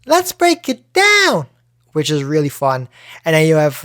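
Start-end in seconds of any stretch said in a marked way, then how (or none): 0.0-1.5 very high, slow, hyped game catchphrase; Lucio impression. Quoting a hero shooter catchphrase, high drawn-out Lucio impression. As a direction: send it way up and stretch the last word. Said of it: "you have Lucio's".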